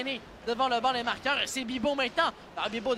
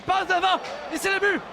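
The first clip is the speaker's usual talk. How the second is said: higher, louder goal call, then slowing; excited sports commentary; 5 semitones higher, 4 dB louder than usual. Real, not acted. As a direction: burst out on the goal, then savor it. Go-ahead goal called. (annonce du but excitée, puis ralentie)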